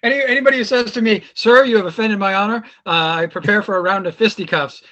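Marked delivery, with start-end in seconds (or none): 1.3-2.6 lightly voiced offended gentleman, raised and louder; mock-indignant gentleman. Podcaster mimicking someone challenging him to fisticuffs, mock indignation. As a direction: play up the wounded honor a little.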